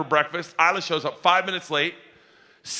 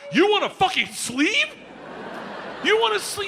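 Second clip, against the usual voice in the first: shocked high shout; 14 semitones higher than usual. Real, not acted. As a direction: yell it in shock. Dad shocked at daughter.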